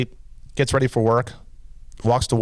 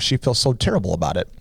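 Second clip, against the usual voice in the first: usual speaking voice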